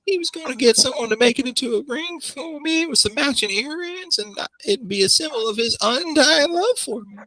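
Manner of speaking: put-on very high, sing-song young woman; high girly voice. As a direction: squeak it out like a pampered girl. NPC girl speaking high-pitched.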